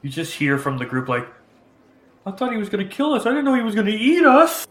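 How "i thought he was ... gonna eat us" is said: high panicked townsfolk voice; scared villager squealing; frightened crowd. Acted.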